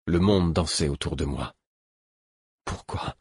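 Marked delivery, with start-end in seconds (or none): 2.6-3.1 hushed, shaking question; trembling whisper. A narrator's reading. Narrator whispers the question, trembling. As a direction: breathe it out, trembling. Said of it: "tremblant de la tête aux pieds".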